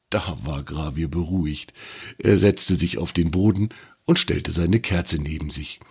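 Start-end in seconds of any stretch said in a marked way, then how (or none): none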